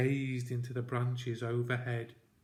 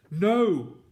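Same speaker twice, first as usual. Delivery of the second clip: sudden loud forceful no; startling force; 7 semitones higher, 11 dB louder than usual. Acted. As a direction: cut him off with one loud no. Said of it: "said his father with startling force".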